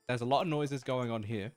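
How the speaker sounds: ordinary talk, nothing marked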